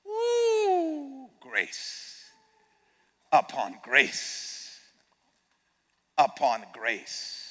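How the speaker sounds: soft, slow, breaking upon grace; hushed grace. Real, not acted. Preacher moved by grace, hushed and slow. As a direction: let it fall to a reverent hush.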